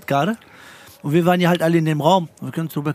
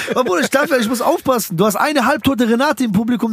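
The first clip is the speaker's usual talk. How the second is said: ordinary talk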